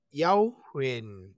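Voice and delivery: HUYEN gliding downward; falling tone. Shown as a demonstration. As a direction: let the pitch fall through "huyen". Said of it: "which is a falling tone".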